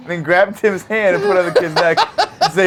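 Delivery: ordinary talk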